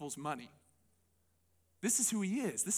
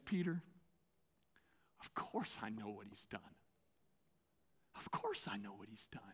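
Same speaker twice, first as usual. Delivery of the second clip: much lower and much softer, tender; Jesus softly reassuring Peter he knows; Jesus gently answering; 10 semitones lower, 10 dB quieter than usual. Retold or imitated. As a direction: answer him quietly and calmly, with patience. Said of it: "I can imagine Jesus in this moment looking at Peter and saying".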